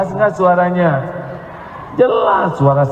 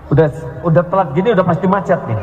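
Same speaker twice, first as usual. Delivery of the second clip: fast grumble; much faster than usual. Retold or imitated. Grumbling about traffic.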